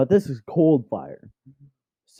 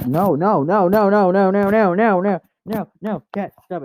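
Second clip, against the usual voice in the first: higher, louder rapid no's; pleading with the cat that hits her gear; real frantic no; 7 semitones higher, 4 dB louder than usual. Real, not acted. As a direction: stop the cat in a panic.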